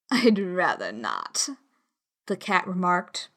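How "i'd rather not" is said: low, slow languid cat; snooty bored cat. A narrator's reading. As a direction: drawl it with disdain. The cat remarks.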